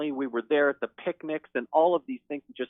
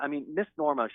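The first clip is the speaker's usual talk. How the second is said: ordinary delivery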